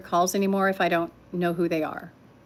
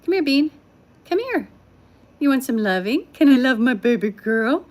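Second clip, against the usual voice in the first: lightly voiced, coaxing cat call; 4 semitones higher, 3 dB louder than usual. Calling her cat Zsa Zsa, lightly coaxing. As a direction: coo to the cat a little higher. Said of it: "If I call her".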